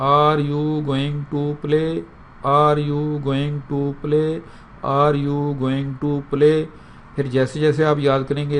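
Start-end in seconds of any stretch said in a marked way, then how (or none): 0.0-6.6 very slow and deliberate; slow drill. Shown as a demonstration. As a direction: stretch out "Are you going to play". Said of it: "آپ نے ایسے یاد کرنا ہے". (بہت آہستہ رٹا)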